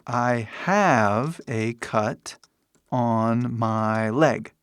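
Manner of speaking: very slow, stretched-out words; slow model sentence. Shown as a demonstration. Teacher offers students another cut example, very slowly.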